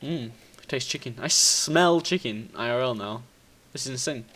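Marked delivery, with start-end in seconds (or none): none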